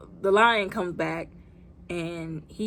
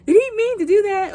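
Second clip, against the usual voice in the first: surprised; 13 semitones higher, 9 dB louder than usual. Retold or imitated. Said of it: "I was like".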